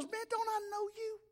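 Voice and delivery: high, soft puzzled woman; a high light woman's voice asking. Acted. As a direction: pitch it up as the woman squinting at him. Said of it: "she goes".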